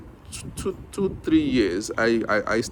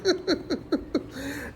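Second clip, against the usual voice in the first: breathy delighted laugh; 7 dB quieter than usual. Real, not acted. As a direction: laugh out softly, charmed. Laughing at child.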